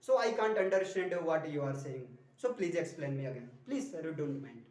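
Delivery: slow, sinking, polite request in a single flow; one smooth flow. Shown as a demonstration. Teacher demonstrating a polite request in one flow. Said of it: "एक ही flow में रहना चाहिए".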